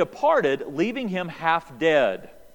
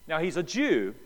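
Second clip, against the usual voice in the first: ordinary talk, nothing marked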